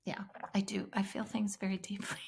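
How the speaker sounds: soft unvoiced whisper. Real, not acted. Mom admitting to cohost she feels deeply, whispered. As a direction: breathe it out, barely there. Quiet admission after emotional memory.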